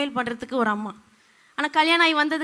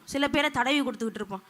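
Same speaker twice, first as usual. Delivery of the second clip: ordinary talk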